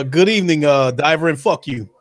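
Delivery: usual speaking voice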